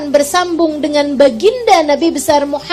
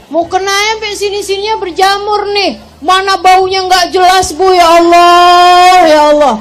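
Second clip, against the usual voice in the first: loud, higher-pitched dismayed outcry; exasperated complaint; 4 semitones higher, 7 dB louder than usual. Acted. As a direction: stretch the cry to God in mock dismay. Mock complaint about mouldy, smelly prayer garment.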